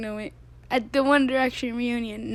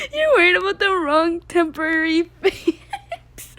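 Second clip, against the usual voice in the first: slow, higher and louder, laughter cracking the words; laughing hard; 7 semitones higher, 8 dB louder than usual. Real, not acted. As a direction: read it while losing it to laughter. Reading tweet, laughing hard.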